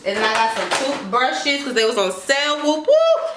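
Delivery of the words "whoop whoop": high, loud whoop whoop; cheer. Real, not acted. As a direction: celebrate a bargain with a shrill cheer. Celebrating sale.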